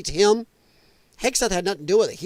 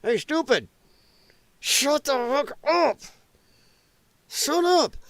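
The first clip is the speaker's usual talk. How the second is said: higher, mocking scold of own party; mock Democrat scolding; 7 semitones higher, slower than usual. Streamer mocking Democrats scolding their own, high sneer. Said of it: "looking at other Democrats going".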